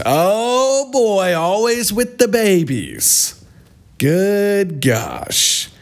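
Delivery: high, slow sarcastic groan; mock exasperation. To viewers, mocking the mom's obsession with her babies, sarcastic. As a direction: roll your eyes and drag it out.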